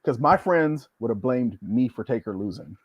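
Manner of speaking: usual speaking voice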